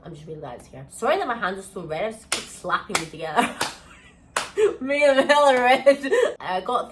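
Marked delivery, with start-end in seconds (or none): 0.0-0.9 relieved. Retold or imitated. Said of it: "I was like".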